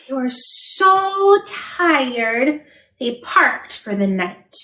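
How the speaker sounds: very slow drowsy reading; slow down, nearly asleep; sleepy slow. Acted. Trucks parking for the night, sleepy and slow. Tired bedtime.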